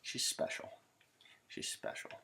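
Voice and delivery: soft breathy whisper; muttered aside about the ex-wife, whispered. Real, not acted. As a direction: whisper it with a knowing pause. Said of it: "I don't know".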